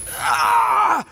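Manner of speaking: sharp loud cry of pain. Acted. Dane feeling the ley line disturbance, a sharp pained cry. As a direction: cry out as if struck by a sudden jolt.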